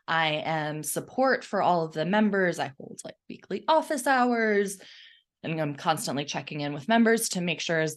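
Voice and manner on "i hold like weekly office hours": ordinary talk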